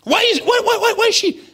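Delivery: very high, loud, repeated demand; frantic questioning. Acted. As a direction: keep pressing where she is. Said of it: "and I asked you".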